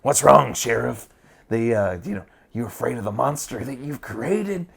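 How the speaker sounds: mock the sheriff in a creepy villain voice. Shown as a demonstration. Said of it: "made it a little more higher pitched".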